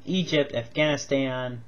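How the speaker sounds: normal talking voice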